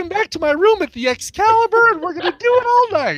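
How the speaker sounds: very high, girlish put-on voice; mock bachelorette girl. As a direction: ham up a tipsy bride-squad girl. Mocking a bachelorette partygoer.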